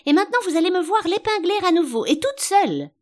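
high, loud, fast boast; triumphant. A narrator's reading. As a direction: proudly announce it at full pitch. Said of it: "d'un ton triomphant".

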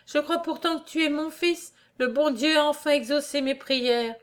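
high-pitched, overcome mother; faint voice. A narrator's reading. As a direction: speak as a mother drained by emotion. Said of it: "disait la mère d'une voix éteinte". (voix éteinte de mère)

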